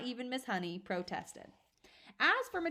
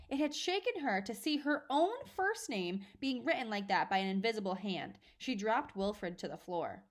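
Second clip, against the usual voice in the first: ordinary delivery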